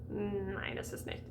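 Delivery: low, soft German aside; mock German reply. Teacher joking in the wrong language, soft German aside. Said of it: "Oh, this isn't German".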